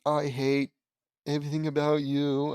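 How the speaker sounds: ordinary delivery, nothing marked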